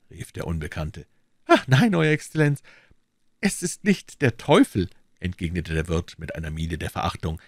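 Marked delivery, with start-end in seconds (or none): 1.5-4.9 high, anxious servile voice; bow and scrape with every word; nervous, eager innkeeper; fawning innkeeper. A narrator's reading.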